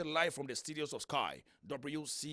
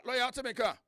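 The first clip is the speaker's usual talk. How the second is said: raised voice, checking the line; calling out; 8 semitones higher, 5 dB louder than usual. Real, not acted. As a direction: call out to a caller on the phone. Host calling guest on phone line.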